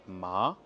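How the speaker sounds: MA rising like a surprised question; questioning upward slide on ma; second tone, astonishment. Shown as a demonstration. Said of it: "ça va être l'étonnement".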